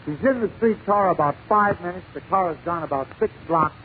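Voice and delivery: usual speaking voice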